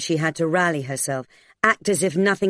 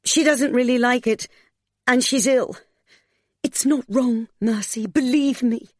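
raised pitch, insistent pleading; urgent persuasion; 8 semitones higher than usual. A narrator's reading. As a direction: press the case anxiously. Desperate man presses his argument.

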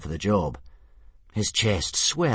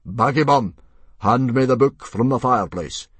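loud, raised commanding call; yelled order; 5 semitones higher, 8 dB louder than usual. A narrator's reading. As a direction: call it across the hall. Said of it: "yelled Stoic".

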